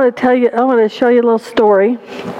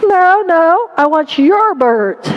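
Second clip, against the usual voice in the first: lightly acted pastor, higher and drawn out; a touch of pleading insistence; insistent pastor; 5 semitones higher, slower than usual. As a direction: insist gently but firmly, pitched up. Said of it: "And the pastor said".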